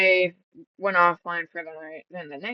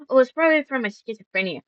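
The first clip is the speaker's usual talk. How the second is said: mocking her excuse; 6 semitones higher, 6 dB louder, much faster than usual. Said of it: "she's like".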